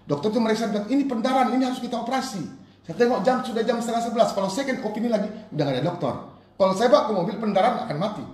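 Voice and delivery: high, loud, urgent storytelling from the pulpit; impassioned preaching. Real, not acted. Preacher retelling a medical emergency to the congregation, loud and urgent. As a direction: drive the emergency home at full force.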